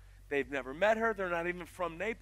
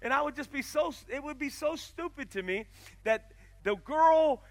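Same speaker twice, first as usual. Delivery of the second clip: usual speaking voice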